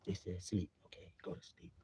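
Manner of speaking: low, fading to a whisper; hushed bedtime voice. Acted. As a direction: murmur it so nobody wakes. Imitates himself hushing toward sleep.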